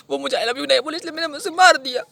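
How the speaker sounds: high-pitched, distraught excuse; wailing justification. Acted. Killer justifies murder to officer, high desperate wail. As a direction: whine it out loudly as if wronged. Wails his excuse for killing.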